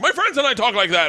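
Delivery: high-pitched imitation of indignation; mock defensive retort. Imitating writer defending his dialogue, mock indignant. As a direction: protest it in a put-on high pitch.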